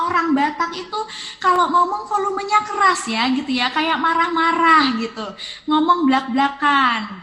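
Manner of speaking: a light take on a loud speaker; mock loud Batak talk. Shown as a demonstration. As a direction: play the loud, angry-sounding talker. Describing loud Batak speech.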